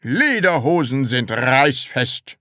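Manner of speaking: slow, emphatic sales claim; department head. A narrator's reading. Department head vouches for the trousers, emphatic. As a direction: draw it out with assurance.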